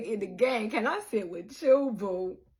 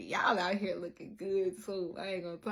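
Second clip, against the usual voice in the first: usual speaking voice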